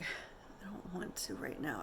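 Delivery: faint, slow whisper, barely there; weak whisper. Retold or imitated. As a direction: breathe the refusal out weakly. Weak mother refusing after birth.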